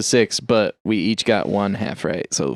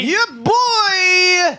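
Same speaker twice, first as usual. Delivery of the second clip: high squeaky echo. Acted. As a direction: squeal the name back. Echoes the intro in a put-on voice.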